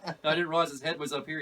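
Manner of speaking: ordinary delivery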